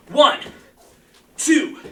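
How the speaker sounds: loud, high counting of reps; energetic coach calling out reps; shouted count. Real, not acted. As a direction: bark out each number to drive the class.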